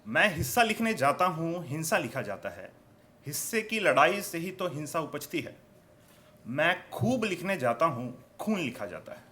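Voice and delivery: declaimed verse with falling line ends; poetry recitation. Acted. Poet repeats the couplet for the audience, declaimed recitation.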